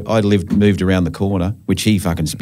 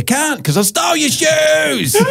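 a high, hammed-up shout of glee; mock triumphant shout; slower than usual. To his ex-mate on the phone, a high gloating yell. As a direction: crow it at your mate like a gloating kid.

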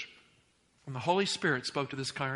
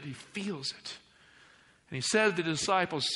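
normal talking voice

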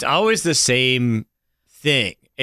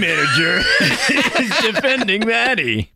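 booming, raised political ad announcer; campaign ad voice; 8 semitones higher than usual. Pretend. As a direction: read it like a campaign spot. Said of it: "I can't do my campaign voice very long".

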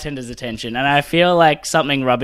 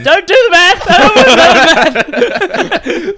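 loud frantic protest; begging them to stop; panicked shout; 9 dB louder than usual. Real, not acted.

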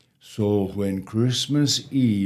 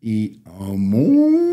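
loud, slow moo stretched through the word; cow lowing; 6 dB louder, slower than usual. Acted. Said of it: "the duck and the cow".

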